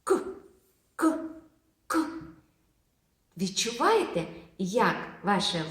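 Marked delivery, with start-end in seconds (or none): 0.0-2.0 «К» said short and breathed out, again and again; sound К demo. Shown as a demonstration. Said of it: "І скажемо цей звук". (вимовляє «к» з видихом)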